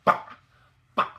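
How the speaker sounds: BA chopped short, falling fast; short, sharp falling BA; fourth tone. Shown as a demonstration. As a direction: drop BA like a karate chop. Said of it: "kind of like a karate chop".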